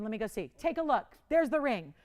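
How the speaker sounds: usual speaking voice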